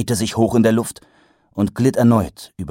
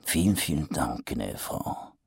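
low, soft, sarcastic rumble; grumbled; 5 semitones lower, 9 dB quieter than usual. A narrator's reading. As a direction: grumble the thanks sourly. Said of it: "brummte Mumm".

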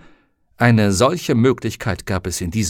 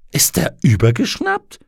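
rat voice; 5 semitones higher, 5 dB louder than usual. Acted. Rat asks incredulously.

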